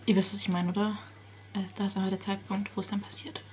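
whispered secret. Real, not acted. Vlogger whispering about the big moment. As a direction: hush it conspiratorially.

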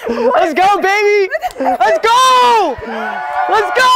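roaring cheer, very high; triumphant shouting. Real, not acted. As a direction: yell it out in triumph. Celebrating after proposal yes.

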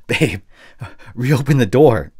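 loud, high-pitched pleading through a door; desperate begging. Acted. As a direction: beg her loudly to let you back in. Begging outside the closed door.

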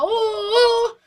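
mock wail. Real, not acted. Wailing frustration.

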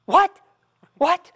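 loud, high-pitched shocked "What? What?"; shocked outburst. Retold or imitated. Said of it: "she was so mad at me".

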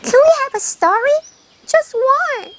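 high, slow pleading hare voice; a small hare begs for one story; hare asking. Acted. 토끼가 거북이에게 조르는 높은 목소리. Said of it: "토끼가 거북이에게 부탁을 하네요".

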